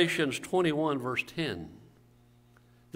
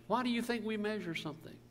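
ordinary talk

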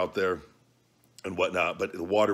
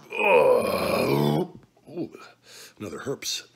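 pained cry through hiccups; 7 semitones higher, 4 dB louder, much slower than usual. Real, not acted. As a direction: gasp out between hiccups. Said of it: "the hiccups are strong on this one".

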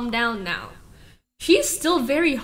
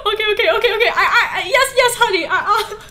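high, loud, laughing fluster; giggly, high-pitched scramble to appease; flustered panic; 7 semitones higher, 6 dB louder, faster than usual. Real, not acted. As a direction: placate in a panic, stumbling and repeating. Rushing to soothe overheating computer.

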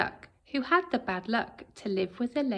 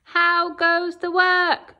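lazy farmer calling; 6 semitones higher, 8 dB louder, slower than usual. Acted. Lazy farmer calling from bed to the duck. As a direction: call down lazily from bed.

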